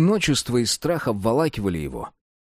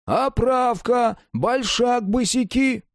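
high, drawn-out barked call; guard's shout; 13 semitones higher, slower than usual. Acted. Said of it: "а стук ключа с окриком".

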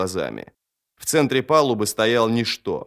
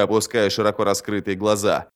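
ordinary delivery, nothing marked

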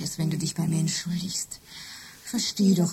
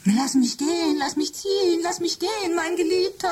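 higher, teasing echo of his plea; mocking sing-song; 11 semitones higher than usual. Computer mocking its trapped owner's plea, teasing sing-song. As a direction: toy with him, drawing the words out.

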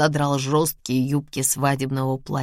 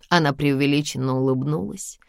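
ordinary delivery

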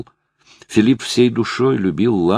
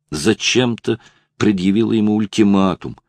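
ordinary delivery

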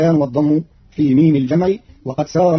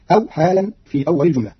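normal talking voice

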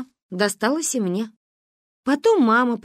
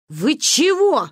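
high, drawn-out incredulous question; shocked interruption; 8 semitones higher, 5 dB louder, slower than usual. A narrator's reading. Mother interrupting the child's explanation, shocked. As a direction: cut in, stretched and aghast.

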